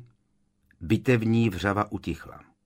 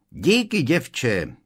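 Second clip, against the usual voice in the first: high-pitched call in passing; shouted out, starting high; shouted thanks; 5 semitones higher, 4 dB louder, much slower than usual. Acted. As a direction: call out a brisk thank-you, pitch leaping up. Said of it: "křikla jakási postava".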